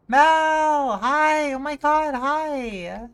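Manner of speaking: high, very loud squeal of welcome; excited greeting. Real, not acted. Streamer greets Mel on arrival, thrilled shout. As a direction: shout a delighted hello, stretched out.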